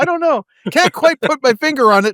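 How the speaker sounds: high, loud fake puzzlement; mock clueless. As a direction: play dumb, hammed-up.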